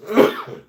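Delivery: sharp cough; a short choking cough. Real, not acted. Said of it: "I'm choking".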